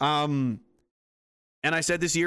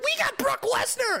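much higher excited squeal; excited activists. Retold or imitated. Activists cheering their new recruit, high squeal. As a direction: squeal with delight. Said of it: "they're like".

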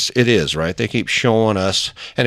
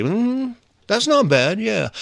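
dreamy delight; 11 semitones higher, slower than usual. Retold or imitated. Imagining a daydream out loud.

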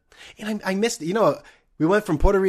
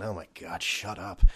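much softer, lower mock grumble; muttered thought; 7 semitones lower, 12 dB quieter than usual. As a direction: grumble it under your breath. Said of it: "She's probably fucking thinking".

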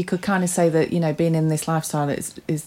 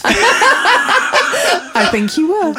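high, slow, giggly tease; laughing; 12 semitones higher, 3 dB louder, much slower than usual. Real, not acted. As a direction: drawl it through giggles. Teasing while laughing.

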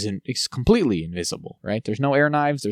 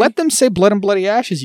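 mock outrage; 8 semitones higher, 4 dB louder than usual. Mock demand to show makers.

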